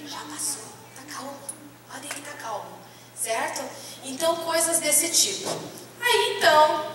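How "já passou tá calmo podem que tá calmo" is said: put-on much softer, lulling a baby; softer soothing murmur of a nurse rocking a baby; soothing hush. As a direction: croon it gently to calm him.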